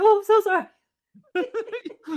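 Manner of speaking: high, very slow, laughing apology; mock apology. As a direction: stretch the sorry out in a squeaky fake voice. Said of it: "And she's like".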